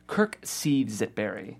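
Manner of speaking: usual speaking voice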